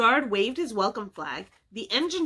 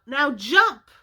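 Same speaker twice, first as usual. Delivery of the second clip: calling out; 4 semitones higher, 6 dB louder than usual. A narrator's reading. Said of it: "he heard the driver call out".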